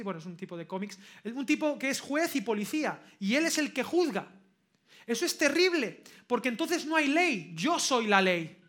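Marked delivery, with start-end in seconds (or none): 1.2-7.5 raised, emphatic pulpit voice; forceful preaching. Real, not acted. Predica con toda su fuerza. 7.5-8.6 loud, slow declaration, lightly acted; juez Dredd. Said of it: "él era el juez Dredd".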